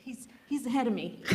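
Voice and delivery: laughs through the words; laughing. Real, not acted. Host amused at a congregant jumping ahead, laughing. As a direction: chuckle it out, amused.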